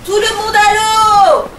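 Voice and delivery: slow, high, loud order; captain ordering everyone into the sea; commanding shout. Acted. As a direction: order them all overboard. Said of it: "ordonne Polo".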